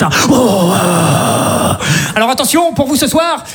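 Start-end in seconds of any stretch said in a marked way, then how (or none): 0.3-1.8 crowd roar imitation. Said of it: "je fais ça dix minutes".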